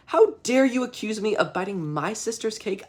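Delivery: high-pitched, offended protest; feigned indignation. Acted. David gaslighting his parents, high mock-indignant denial. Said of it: "David is gaslighting his parents".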